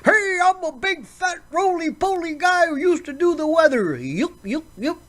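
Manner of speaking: high, hammed-up goofy weatherman; mock the jolly TV weather guy, yucking it up; silly, high chuckling weatherman caricature; Al Roker impression. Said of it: "And you got Al Roker".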